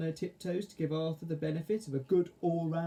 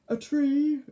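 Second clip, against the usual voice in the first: yawning; 12 semitones higher, 8 dB louder than usual. Acted. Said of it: "yawned Carwash".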